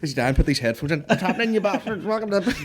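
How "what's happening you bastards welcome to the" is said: high, put-on host voice; high hammed-up greeting; mock podcast intro. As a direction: launch into a hammed-up show open.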